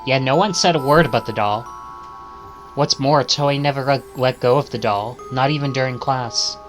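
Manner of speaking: ordinary talk, nothing marked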